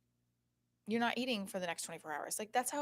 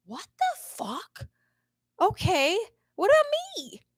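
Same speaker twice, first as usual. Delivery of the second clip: mock outrage; 13 semitones higher, 11 dB louder than usual. Host reacts to the guy's answer, mock outrage. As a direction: exclaim it high and loud, hammed up.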